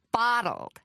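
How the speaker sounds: American visitor trying out the word; American accent. A narrator's reading. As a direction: repeat "Bottled" puzzled, in an American accent. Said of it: "Logan Royce had an American accent".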